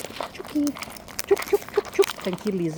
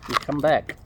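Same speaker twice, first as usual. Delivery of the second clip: normal talking voice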